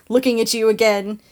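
normal talking voice

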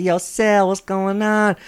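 high, loud hyped-up street greeting; slipping into friend's slang. Retold or imitated. Answering his rapper coworker's call, hyped slangy greeting. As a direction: copy the rapper's catchy hello. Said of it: "the way he talks is hypnotizing".

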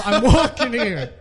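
high, mock New York holler; shouting New Yorker. Host imitating a sea of New Yorkers, high brash accent. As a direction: call it out like a cartoon New Yorker. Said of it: "They all say".

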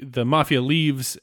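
ordinary talk, nothing marked

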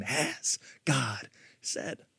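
slow, voiceless whisper; whispered serpent. Acted. Preacher whispering the serpent's question, slow and sly. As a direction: breathe it out like a sly doubt.

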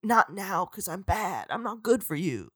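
put-on soft, hushed moody boyfriend; hushed hammed-up heartthrob; mock brooding Jacob. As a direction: murmur it low and soft like a tortured heartthrob. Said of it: "And Jacob's like".

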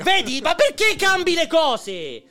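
loud exasperated scolding. Real, not acted. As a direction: tell him off loudly. Scolding colleague over monitor change.

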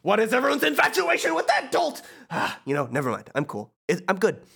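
sudden high exasperated outburst, then cool; smug villain losing and regaining his cool; Hades voice. Acted. Hades, irked at the heroes' question. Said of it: "Hades voice".

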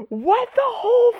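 high, shocked squeak; mock shocked yelp. Jason learning Batman has a new Robin, shocked high squeak. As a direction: squeal it in disbelief. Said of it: "and he's like".

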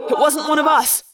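mildly shouted reassurance; called answer. Acted. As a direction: call back to a worried friend. Shouted answer amid the attack.

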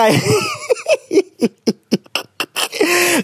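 long, high giggling fit; helpless laughter. Real, not acted. Host breaking down laughing while announcing the next letter. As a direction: lose it completely in high laughter.

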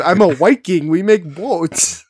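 exaggerated sing-song Viking accent; weird Viking accent. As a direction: put on a silly accent for a Viking, stretching the last words. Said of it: "like, a weird, like, accent".

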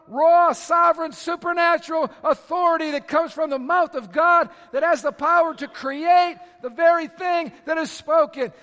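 preaching at full force. Acted. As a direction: build to a fiery climax. Preaching climax.

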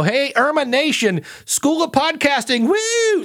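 high, hyped-up cheer; mock hype entrance. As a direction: burst in like an overeager hype man. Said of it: "Then I didn't walk in and go".

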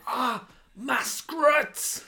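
high, slow, bad Scottish accent; a hammed-up Scottish voice; clumsy Scottish accent. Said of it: "What accent was that?".

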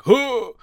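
sharp high mock huh of amazement; poggers dude huh. To Riley, mimicking the hyped streamer's mock-amazed huh. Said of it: "the dude that's like".

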